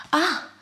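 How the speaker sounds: sharp surprised ah at a kick; startled gasp. Acted. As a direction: gasp as the baby kicks. Baby kicks hard.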